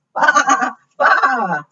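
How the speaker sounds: high, wavering baa; sheep bleat. Acted. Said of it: "bleated the sheep".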